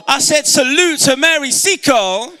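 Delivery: high, loud call to the crowd; rallying shout. Real, not acted. Speaker rallying the audience to salute a heroine, rousing shout. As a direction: shout it out to rouse the hall. Rallies crowd after asking for woo.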